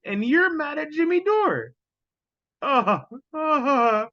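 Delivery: high laughing voice breaking into sobs of laughter; laughter overwhelming the words; wheezing with laughter; laughing hard. Real, not acted.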